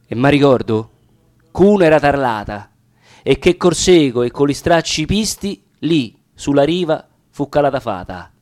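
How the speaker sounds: ordinary delivery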